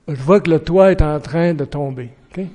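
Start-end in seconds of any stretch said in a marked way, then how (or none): none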